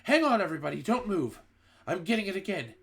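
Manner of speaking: high, urgent sniffing alarm; urgent witch. A narrator's reading. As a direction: raise the alarm as the smell hits. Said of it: "cried the witch called Mildred".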